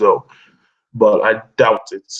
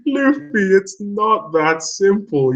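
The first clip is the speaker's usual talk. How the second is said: mock character voice; 9 semitones higher, much slower than usual. Mocking a character's slow objection.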